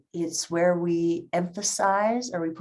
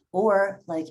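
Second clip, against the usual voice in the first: ordinary delivery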